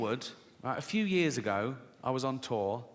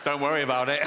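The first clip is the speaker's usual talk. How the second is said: smug; 3 semitones lower, 4 dB louder, much faster than usual. Retold or imitated. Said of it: "he's all smug then".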